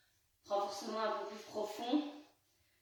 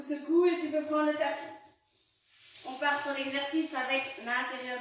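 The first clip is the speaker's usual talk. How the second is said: louder, higher encouragement; pumped-up fitness coach; 4 semitones higher, 5 dB louder than usual. Acted. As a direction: cheer them through the squats. Coach pushing through squats.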